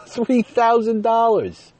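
high, loud, slow laughing exclamation; laughing disbelief. Real, not acted. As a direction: cry out the sum in shocked amusement. Disbelief at the huge bill.

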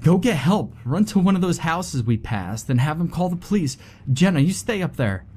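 high, urgent commands; urgent orders. A narrator's reading. Ellie urgently orders her friends to get help. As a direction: bark out orders in a crisis.

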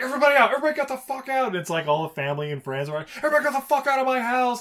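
high, loud hammed-up shouting; mock furious yell. Brian throwing everyone out of the house, a hammed-up yell. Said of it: "He's also like".

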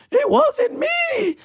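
very high, slow shrieked "It wasn't me"; squealing mock shout. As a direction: shriek the denial in panic. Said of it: "I'm doing the old".

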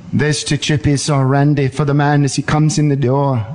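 put-on German-accented narrator parody; imitated German voice-over; Werner Herzog. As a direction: give a running commentary in a mock German accent. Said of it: "use your accent".

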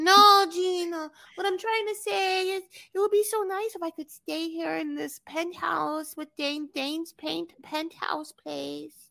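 high, slow wheedling voice; wife coaxing husband to keep the penthouse; mimicking Jasmine. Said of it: "She's like".

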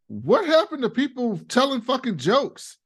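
Logan's gruff demand for jokes, hammed up; imitating Logan. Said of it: "he goes like".

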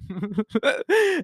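high burst of laughter; amused laugh. Real, not acted. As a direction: crack up mid-story. Amused by the actor's scene.

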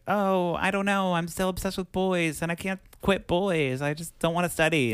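put-on high, whiny girly voice; little girl. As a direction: play a boy-crazy kid. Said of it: "the little girl's like".

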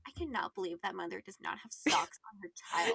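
whispered judgement; judgy whisper. Retold or imitated. Judging other moms, whispered gossip. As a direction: hiss it under the breath like gossip. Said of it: "I would be like".

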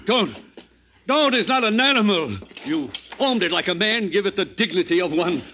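high, loud, urgent pleading; desperate plea. Acted. Friend pleads against the dissection.

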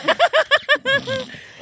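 shrieking high laugh. Real, not acted. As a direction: crack up laughing. Laughs at air horn.

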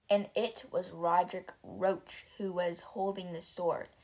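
usual speaking voice

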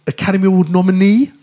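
normal talking voice

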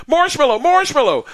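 high, loud mock cry for help; retold panicked yell. Yelling for rescue from the bear's grip, panicked. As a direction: shout the safe word in comic panic.

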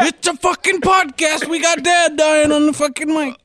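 very high-pitched yelling; hammed-up, high-pitched scolding; mock outburst. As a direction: yell it high in mock protest. Mock scolding amid loud banter.

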